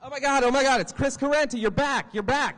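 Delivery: high, loud, breathless-with-news greeting; frantic relief. Acted. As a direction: cry out in amazed recognition. Acted frantic greeting of a survivor.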